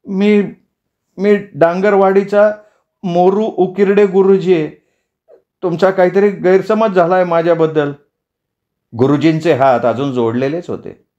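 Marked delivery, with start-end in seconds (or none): 0.1-7.9 high, halting, meek voice; nervous introduction. Acted. Captive teacher tells the mob his name, high halting meek voice. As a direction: introduce yourself hesitantly and plead a misunderstanding.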